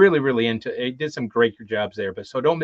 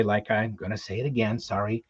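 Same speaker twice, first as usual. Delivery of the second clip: normal talking voice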